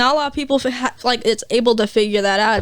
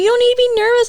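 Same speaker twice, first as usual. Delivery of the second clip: put-on reassuring boyfriend; 11 semitones higher, 5 dB louder, faster than usual. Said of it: "he was like".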